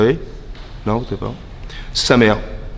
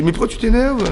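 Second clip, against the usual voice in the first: much higher, teasing disbelief; mock incredulous question; 10 semitones higher than usual. Host to snubbed guest, mock incredulous. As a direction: ask it as if there were no reason, pitched way up.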